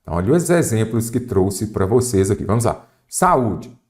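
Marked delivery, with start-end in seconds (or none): none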